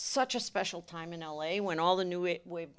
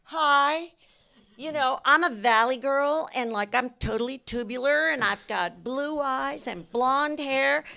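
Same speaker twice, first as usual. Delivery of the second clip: put-on Valley Girl contestant; 7 semitones higher, 8 dB louder than usual. Director imitating contestants introducing themselves at a Valley Girl contest, Valley girl voice.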